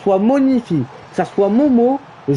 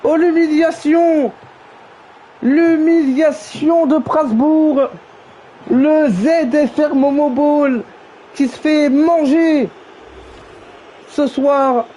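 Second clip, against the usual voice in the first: high, slow, drawn-out goal call; milk every word of the rout; excited goal commentary; 6 semitones higher, slower than usual. Real, not acted.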